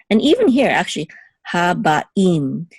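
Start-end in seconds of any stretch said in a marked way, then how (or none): 1.5-2.7 Habaim with a break before "im"; separated sounds. Shown as a demonstration. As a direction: part "Haba" from "im" in Habaim. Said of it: "we're gonna separate the sounds".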